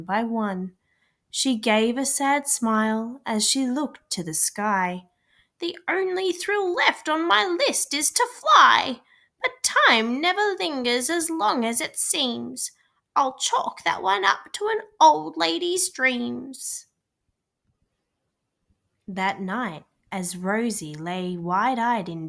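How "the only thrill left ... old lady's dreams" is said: put-on higher, slightly louder old lady voice, wistful; an elderly aunt sighing over a last dream. A narrator's reading. Great-great-aunt tells Rosie her last wish, wistful old voice.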